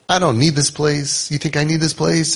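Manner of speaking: mock-defiant high retort. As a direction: throw it back at him like an insulted kid. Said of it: "And he cursing me".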